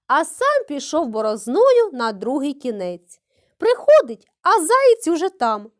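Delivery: plain reading voice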